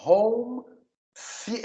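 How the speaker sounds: "Home the-" slowly, stopping on the TH syllable; TH sound. Shown as a demonstration. Teacher isolates T H, slow "Home the-". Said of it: "é esse T H".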